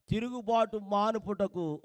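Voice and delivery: usual speaking voice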